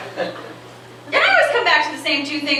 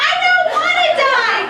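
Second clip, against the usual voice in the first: put-on much higher, louder child's panicked scream; 4 dB louder than usual. Little kid in the waiting room screaming in terror, much higher. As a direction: shriek it like a terrified little kid. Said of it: "the little kid screaming".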